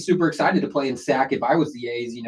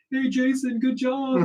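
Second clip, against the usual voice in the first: mimicked yelling dad; 10 semitones higher, 3 dB quieter, slower than usual. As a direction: imitate an overeager dad cheering on pitchers. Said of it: "yelling at the relief pitchers".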